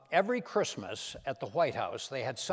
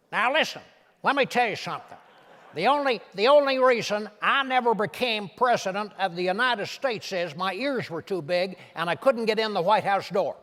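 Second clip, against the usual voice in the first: Ross Perot impression; 7 semitones higher, 7 dB louder than usual. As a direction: bark it out like a blunt Texas billionaire on the stump. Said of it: "That's Ross Perot".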